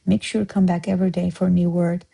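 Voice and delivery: ordinary talk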